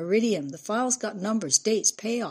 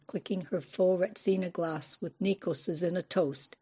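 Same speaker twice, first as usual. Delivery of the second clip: ordinary delivery, nothing marked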